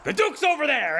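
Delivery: high shouted direction; calling out. Acted. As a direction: point and call across the street. Rahi calling and pointing.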